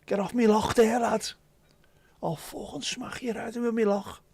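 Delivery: high-pitched, exaggerated Scouse threat; thick Scouse with throaty ch sounds. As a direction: do a hammed-up angry Liverpudlian. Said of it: "something you put round your bike in Liverpool".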